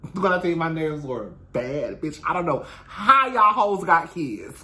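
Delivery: ordinary talk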